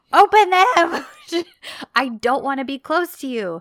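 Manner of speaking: high, very loud retold demand; exasperated shout. Acting out Eda demanding Serkan open the cuffs, exasperated shout. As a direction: yell it as an angry imitation, laugh breaking in. Acted quarrel over handcuffs.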